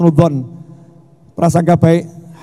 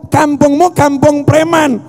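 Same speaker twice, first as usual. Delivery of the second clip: preaching shout; 8 semitones higher than usual. Real, not acted. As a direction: preach it hard, climbing to a shout. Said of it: "saya ngomongnya lemah lembut atau teriak-teriak".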